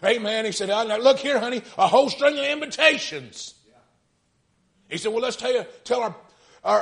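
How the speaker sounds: high, loud preaching cry; preaching at full force. Acted. Preacher retelling the story, full-force preaching.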